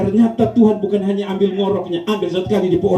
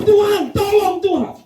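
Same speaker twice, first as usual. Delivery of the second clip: high, slow, crying-out plea to God; a wailing wife begging heaven; wife's desperate plea; 14 semitones higher, slower than usual. Retold or imitated. Wife begging God to stop husband's snoring, high wail. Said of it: "mulai mengeluh, "Tuhan".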